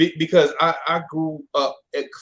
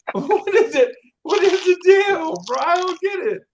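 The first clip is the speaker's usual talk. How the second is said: laughing; 8 dB louder than usual. Real, not acted. Cracking up at Jamaicans, machetes.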